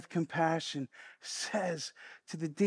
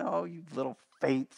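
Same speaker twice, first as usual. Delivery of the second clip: softer, lower, fatherly "Oh, you little faith"; tender Papa Jesus; 5 semitones lower, 5 dB quieter than usual. Shown as a demonstration. Preacher voicing Jesus comforting frightened disciples, tender. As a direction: coo it like a father soothing a scared child. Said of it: "Papa Jesus picking up his little ones".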